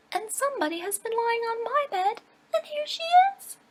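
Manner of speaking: little bear's shrill, piercing pipe; sharp shrill little bear. Acted. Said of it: "so sharp and shrill".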